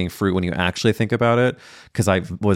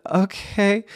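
stunned okay; 12 semitones higher than usual. Retold or imitated. Deadpan reply to wrong hotel.

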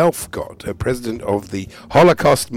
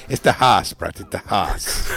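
low, slow character voice; put-on voice; 5 semitones lower than usual. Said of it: "Doing them all today".

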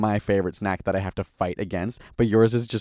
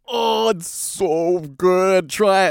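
high, slow, gushing mock voice; mock enthusiastic friend; 9 semitones higher, much slower than usual. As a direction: gush over the snack in a silly high voice. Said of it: "And you'll be like".

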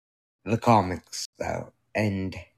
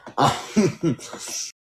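breathy laugh; 8 semitones higher than usual. Real, not acted. Laughs after joke about Shazam.

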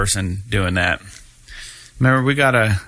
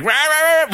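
high, loud playful rah rah; mock battle roar; 4 dB louder than usual. Host imitating Ozzy yelling in the promo, high mock roar. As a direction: mimic a rocker flailing at a virtual dinosaur. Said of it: "he's like".

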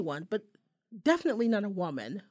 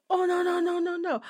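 high, rapid string of refusals; flustered denial; 8 semitones higher, 4 dB louder than usual. Acted. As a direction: imitate a man hurriedly backing off. Acts man hastily denying.

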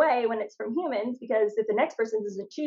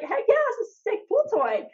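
put-on high, bright-eyed kid bragging; a much higher, proud little-kid voice; 11 semitones higher than usual. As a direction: show off your prize like an eight-year-old. Proud child shows off shark.

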